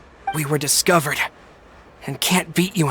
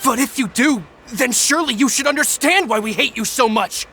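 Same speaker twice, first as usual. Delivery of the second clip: raised, higher and angry; indignant outburst; 8 semitones higher than usual. Acted. Thug snapping back at the gang boss, indignant. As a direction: throw the accusation back at her.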